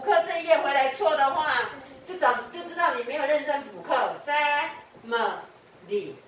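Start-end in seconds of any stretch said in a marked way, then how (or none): 4.3-6.1 "Family" very slowly in three separate syllables. Shown as a demonstration. Teacher points out syllables for stress, "Family" said syllable by syllable. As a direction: split "Family" into fa, mi, ly with gaps. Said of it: "family它的重音".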